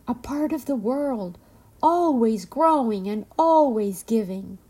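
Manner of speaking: plain reading voice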